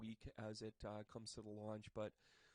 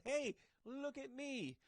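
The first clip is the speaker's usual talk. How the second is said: high, slow, swaggering show-off; a high, drawn-out brag from the cocky king; badass king; 13 semitones higher, much slower than usual. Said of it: "the King of Wands is badass sitting there going".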